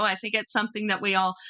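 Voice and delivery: ordinary talk, nothing marked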